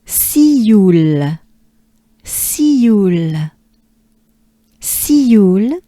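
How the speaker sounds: "Sioule" stretched out in French; French Sioule, see-you gliding into a final L; French pronunciation demo. Shown as a demonstration. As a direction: model Sioule long and emphatic. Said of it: "take a good breath together".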